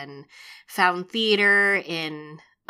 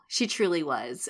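ordinary delivery

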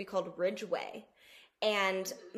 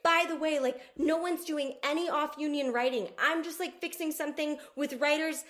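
high, loud, rushed over-explaining; nervously justifying herself, breaking into laughter; flustered pleading; 8 semitones higher, 6 dB louder than usual. Retold or imitated. Retold pleading to picketing writers.

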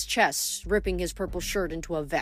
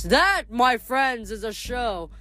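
smug god; 5 semitones higher than usual. Acted. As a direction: savor the boast. Boastful god gloats.

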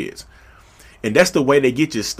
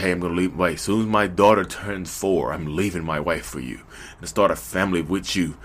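low, softer lover's promise; earnest promising; 5 semitones lower, 3 dB quieter than usual. Acted. Married person promising a lover to leave, earnest and low. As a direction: make a hushed, low-pitched vow to a lover.

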